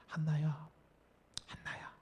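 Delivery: a faint divine voice calling from afar; a hushed whisper, fading to almost nothing. Acted. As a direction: whisper the name, barely voiced. God's voice calling Hannah.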